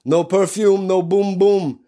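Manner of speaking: high-pitched mock Nigerian sales patter; imitated Nigerian accent. Bathroom attendant selling perfume, in a mock Nigerian accent. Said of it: "he'd say stuff like".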